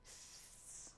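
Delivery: very soft drawn-out hiss; low-volume shushing sound; warning hiss. A narrator's reading. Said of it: "warned Haribon".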